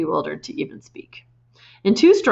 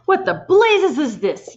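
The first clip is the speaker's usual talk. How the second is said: much higher, slow furious yell; 11 semitones higher, 5 dB louder, slower than usual. A narrator's reading. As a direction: bellow in shock. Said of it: "yelled the Trenchbull".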